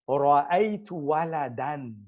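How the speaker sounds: "Ra'aytu waladan" spoken slowly, each syllable clear; slow Arabic demo. Shown as a demonstration. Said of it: "we say".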